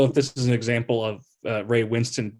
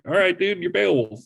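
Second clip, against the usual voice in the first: higher-pitched, teasing acted retort; mock placating reply; 7 semitones higher than usual. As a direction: humor him in a raised voice. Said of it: "I'm like".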